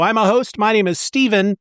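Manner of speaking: usual speaking voice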